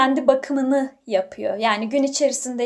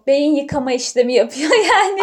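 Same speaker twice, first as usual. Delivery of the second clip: laughing; 2 semitones higher, 7 dB louder than usual. Real, not acted. Joking about brain washing.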